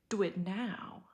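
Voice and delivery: ordinary delivery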